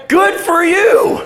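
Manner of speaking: very high, loud, drawn-out cheer with a laugh; exuberant congratulation. Acted. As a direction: whoop it out slowly, hammed-up delight. Cheers mom going to heaven.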